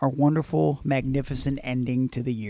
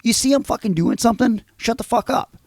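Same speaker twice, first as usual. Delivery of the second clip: higher, mock-furious comeback; imagined angry retort; 7 semitones higher than usual. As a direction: snap back like you wish you had. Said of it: "I wanted to be like".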